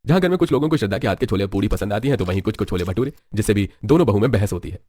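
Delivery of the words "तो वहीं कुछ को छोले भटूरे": ordinary delivery, nothing marked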